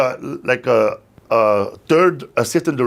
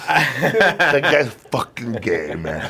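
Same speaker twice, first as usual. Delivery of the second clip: drawn-out, very slow, sneering; slow mocking mutter with a laugh; slow muttered insult; much slower than usual. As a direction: stretch every word of the insult slowly. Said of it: "And I went like this".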